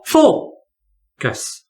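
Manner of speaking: focus split slowly as FO ... cus; stress on first syllable. Shown as a demonstration. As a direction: stretch the FO of focus, pause, drop cus. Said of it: "stress is before the final syllable".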